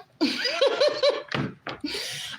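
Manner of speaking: high, real laugh bursting out. Real, not acted. Laughing at a viewer asking if she's single. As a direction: laugh it off, delighted.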